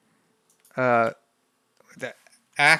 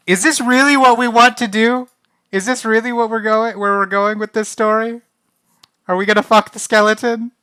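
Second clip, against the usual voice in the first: high, loud disbelieving questions; pitched way up, can't believe this is happening; incredulous questioning; 8 semitones higher, 5 dB louder than usual. Real, not acted. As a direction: ask it in raised-pitch dismay. Dismayed questioning of where story goes.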